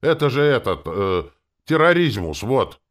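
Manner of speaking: loud, high, fumbling outburst; aghast exclamation. A narrator's reading. Coachman accuses passenger of terrorism, aghast. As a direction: search for the word, then blurt it out.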